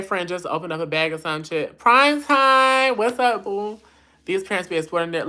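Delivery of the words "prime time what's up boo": sing-song shout-out. Real, not acted. Greeting a viewer named Prime.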